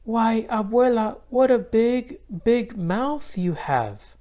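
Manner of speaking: put-on slow down and wonder like a child; high, slow, wide-eyed girl; wondering little girl. A narrator's reading. Isabel questioning the disguised wolf.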